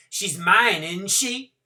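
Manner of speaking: put-on loud, high, breezy young mother; gushing, careless Courtney. Courtney voiced.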